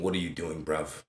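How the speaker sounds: lightly imitated British bloke, said low; British accent. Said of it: "that's my British accent".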